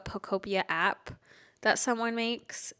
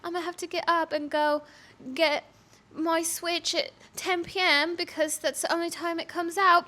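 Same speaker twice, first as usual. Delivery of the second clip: higher-pitched put-on British accent; mock British; 6 semitones higher than usual. Pretending to schedule play at ten P M, mock British. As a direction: carry on the British act, rising at the end. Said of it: "I don't know why I turned British".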